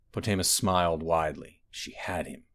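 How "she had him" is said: ordinary delivery, nothing marked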